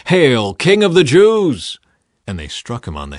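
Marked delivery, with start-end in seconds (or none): 0.1-1.8 loud, high, slow mocking salute; taunting. A narrator's reading. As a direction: sneer out the greeting to mock him.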